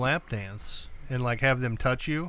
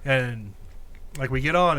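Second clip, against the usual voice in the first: ordinary delivery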